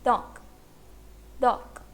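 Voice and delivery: "दॉक" short, closing only on a K; pronunciation demo. Shown as a demonstration. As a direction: model "दॉक" as one careful syllable with a stopped K. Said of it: "तो word का pronunciation क्या हुआ?". ("दॉक" का उच्चारण, अंत में सिर्फ़ क)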